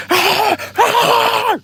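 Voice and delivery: very high shouted ah ah; dog's warning shout. Retold or imitated. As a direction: bark a sharp warning up high. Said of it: "She's like".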